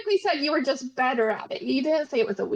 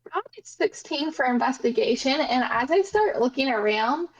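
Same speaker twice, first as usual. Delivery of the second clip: ordinary delivery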